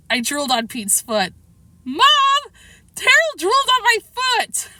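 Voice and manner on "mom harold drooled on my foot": put-on very high, loud kid's outcry; yelling for Mom. A narrator's reading. Boy tattling to his mother about the dog, high yell. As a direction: holler in a shrieky child's complaint.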